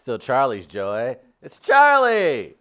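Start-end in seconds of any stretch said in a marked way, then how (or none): 1.4-2.6 loud, high, drawn-out exclamation; mock-indignant cry. Comic defending his shirt friend to co-host, loud mock indignation. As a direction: stretch the name into a playful shout.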